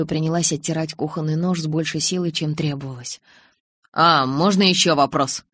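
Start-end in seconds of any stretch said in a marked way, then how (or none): none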